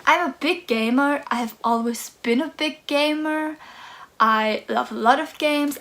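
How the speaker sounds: usual speaking voice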